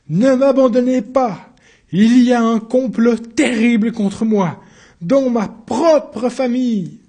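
high, loud, slowed pleading in another voice; desperate plea. Acted. Accused pleading with counsellor Coras, loud slow plea. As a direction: beg the judge not to abandon him. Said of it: "quelque chose le touchait dans la voix".

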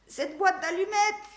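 sudden pained realisation, rasped; grating cry. Acted. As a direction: grind out the words in pain. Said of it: "grinça-t-il".

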